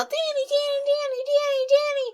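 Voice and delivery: high, sing-song calls of a name, repeated; kids calling out. Retold or imitated. Kids running down the hill calling to Danny, in a high sing-song. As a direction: call him like a small child running downhill.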